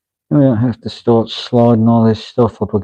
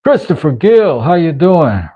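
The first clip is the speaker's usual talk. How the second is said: hammy greeting; 7 semitones higher, 4 dB louder than usual. Pretend. Over-the-top mock greeting.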